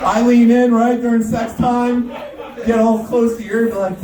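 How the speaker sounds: ordinary talk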